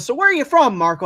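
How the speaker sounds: lightly voiced [name] testing the intern; teasing [name]. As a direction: play dumb to needle the intern. [name] teasing about hometown.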